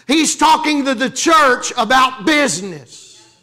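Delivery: drawn-out preaching shout; emphatic preaching. Real, not acted. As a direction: hammer the point home to the church. Preacher stressing the point.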